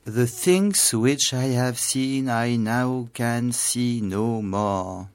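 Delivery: slow, lifted, solemn reading of Wordsworth; poem recitation. Shown as a demonstration. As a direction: recite it as a cherished verse. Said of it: "je le recite".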